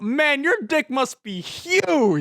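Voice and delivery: loud high slow yell. Retold or imitated. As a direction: bellow it out. Yelling at a passing driver.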